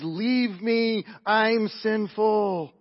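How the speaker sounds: high, loud, drawn-out plea; anguished cry. Acted. Peter falling at Jesus' knees, anguished cry. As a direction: cry it out like a preacher acting repentance.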